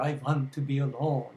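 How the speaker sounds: cartoon-style Swedish accent, w said as v; cartoon Garbo impression. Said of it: "If you wanted to do Greta Garbo".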